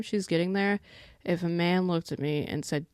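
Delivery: normal talking voice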